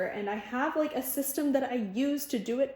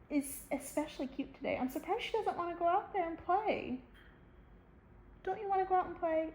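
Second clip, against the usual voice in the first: higher, sing-song voice for the dog; dog talk; 8 semitones higher, 5 dB quieter than usual. Real, not acted. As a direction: coo at the dog. Talking to the dog.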